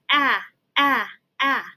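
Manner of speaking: crisp repeated ah, ah, ah; short a sound. Shown as a demonstration. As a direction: clip the short vowel sound. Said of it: "and A says".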